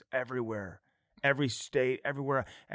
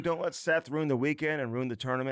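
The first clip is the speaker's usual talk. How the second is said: loud, higher hype pitch, slow start; hype ad read; 5 semitones higher, 4 dB louder than usual. Acted. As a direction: pump up the sponsor like an announcer. Host shouts sponsor ad.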